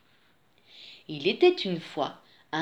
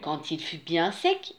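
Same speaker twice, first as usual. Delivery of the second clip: plain reading voice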